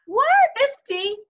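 high, astonished "Fifty?"; surprised. Real, not acted. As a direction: squeak it out in disbelief. Reacting to hearing fifty episodes.

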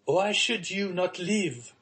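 high, slow desperate plea; anguished cry. A narrator's reading. Son begging his father to live, high anguished plea. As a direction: cry out the question as if it breaks you. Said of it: "cried the young man".

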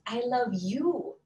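slow, "you" lifted high; stress on you. Shown as a demonstration.

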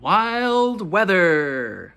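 dramatic title call. Acted. Reader proclaiming the book title to kids, slow booming announcement. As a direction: boom the title like a trailer voice.

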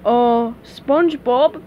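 slow, hesitant character question; Patrick, sheepish. A narrator's reading. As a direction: break it to a friend sheepishly. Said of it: "Patrick said sheepishly".

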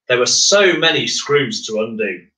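usual speaking voice

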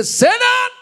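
very high, loud shout of rebuke; shouting down Satan in prayer; commanding shout. Retold or imitated. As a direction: cast him out with one cry. Church praying against Satan.

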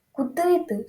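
frightened yelp; cried out. A narrator's reading. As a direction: cry out in alarm. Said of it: "зойкнув я".

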